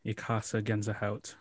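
quiet German words; German phrase. Shown as a demonstration. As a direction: softly try out a German line. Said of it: "speaks German".

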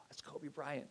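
barely-there whisper, calling his daughter; hushed nudge. Retold or imitated. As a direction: whisper so the famous family won't hear. Dad nudging daughter near celebrity.

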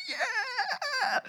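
put-on high child's squeal of joy; high-pitched happy cry; excited squeal. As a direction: squeal like a kid on Christmas morning. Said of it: "and you get this".

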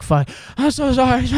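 high, panicked sorry; panicked apology. Retold or imitated. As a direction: blurt it out in a panic.

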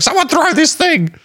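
high squeaky voice for the cat; cat's voice. Pretend. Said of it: "be like".

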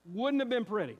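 ordinary delivery

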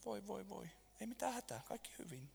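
calm the little one gently; soothing hush. Real, not acted. Soothing a fussing baby off mic.

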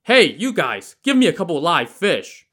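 loud, high call to the boats; shouting. A narrator's reading. Li Kui to fishermen at the boats, loud demanding shout. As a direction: shout a demand across to them. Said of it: "walked down to the boats and shouted".

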